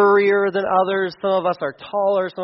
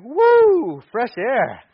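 relieved whoop; 5 semitones higher, much slower than usual. Real, not acted. Pulls off bag, relieved.

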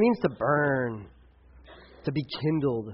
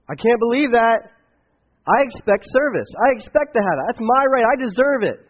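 louder and higher, acting out an entitled rant; entitled whiner; 8 semitones higher, 8 dB louder than usual. Preacher mocks himself over lost cell signal, a loud huffy rant. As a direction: play a spoiled phone owner who expects service. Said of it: "this attitude of, of entitlement".

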